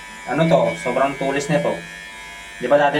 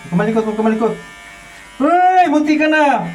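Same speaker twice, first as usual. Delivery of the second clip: sharp scolding; 9 semitones higher than usual. Real, not acted. Scolding child who won't keep still. (matinis na saway)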